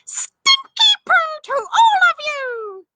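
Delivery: very high, slow furious witch screech; drawn-out shrieking witch; angry witch scream. Acted. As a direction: spit the curse after them. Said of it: "she was so angry she screamed".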